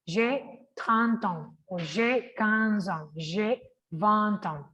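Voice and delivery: J'ai trente ans, vingt ans, slow, the T linked onto ans; slow liaison demo. Shown as a demonstration. Teacher drilling ages for the class, slow and clear. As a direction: say each age slowly, the T running into ans. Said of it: "Qué es lo que pasa con este an".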